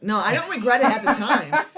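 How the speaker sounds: real laugh while admitting it. Real, not acted. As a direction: giggle through the confession. Laughs at her own cruelty.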